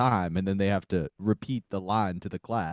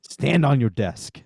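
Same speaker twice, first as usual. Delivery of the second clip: loud, raised call to the class; rousing teacher; 5 semitones higher, 6 dB louder than usual. Acted. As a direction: command it like an inspirational teacher. Teacher rousing pupil, Dead Poets bit.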